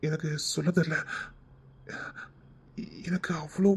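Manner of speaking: soft whispered threat; menacing whisper. Acted. Husband menacing the wife in a whisper. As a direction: breathe it out like a threat.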